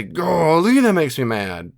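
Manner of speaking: loud, high "golly", then easing down; acted exasperation. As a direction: vent the anger briefly, as a demonstration. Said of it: "If you say".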